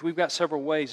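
ordinary talk

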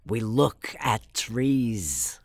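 slow whispered aside; hushed, slow deadpan whisper. Acted. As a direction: breathe it out slowly as a dry joke. Deadpan aside about their duties.

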